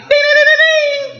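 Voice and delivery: sing-song ding. Pretend. Alarm bell imitation.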